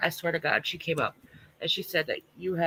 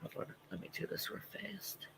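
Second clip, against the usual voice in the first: whisper; 3 semitones higher, 18 dB quieter than usual. Real, not acted. Quick aside off mic.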